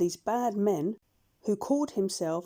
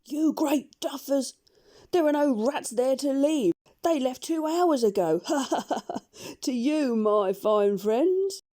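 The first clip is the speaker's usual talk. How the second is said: higher, louder jeering with a laugh; taunting dog shout; 5 semitones higher, 4 dB louder than usual. A narrator's reading. Dog shouting across to the sinking pirates, jeering. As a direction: crow at the pirates and laugh them off. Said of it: "Jip shouted across from the other ship".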